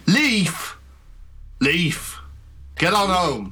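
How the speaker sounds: shouting. Acted. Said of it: "Adam out front shout".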